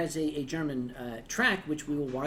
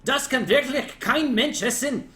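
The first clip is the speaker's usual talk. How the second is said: mock German phrase; 6 semitones higher, 7 dB louder than usual. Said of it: "you can say".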